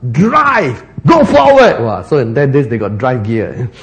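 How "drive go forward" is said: high, punchy order to charge ahead; urgent command. Retold or imitated. Woman urging her donkey driver onward, urgent command. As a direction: call it out high and sharp, urging on.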